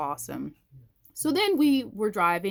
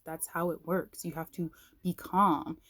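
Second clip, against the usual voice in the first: ordinary delivery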